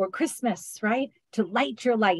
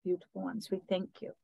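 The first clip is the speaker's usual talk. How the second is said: channeled beings of light; 3 semitones higher than usual. Acted. Healer channels the beings of light to bless listeners, solemn otherworldly voice. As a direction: speak as light beings addressing their beloved ones.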